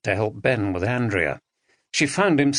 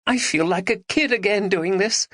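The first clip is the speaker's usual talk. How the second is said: Maggie, playful; 9 semitones higher than usual. A narrator's reading.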